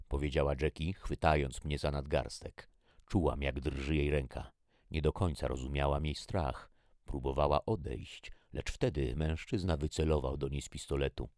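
plain reading voice

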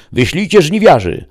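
high, loud command; shouted order. A narrator's reading. Chief entering underground ordering reapers sent, shouting. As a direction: yell the order down the hall. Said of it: "wykrzyczał".

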